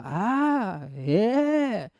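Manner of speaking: "A" and "E" held long, high and swooping; open sustained vowels. Shown as a demonstration. Teacher repeating the vowel demo, long high open vowels. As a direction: belt out "A, E" as long free vowels. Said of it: "era meio papo de louco".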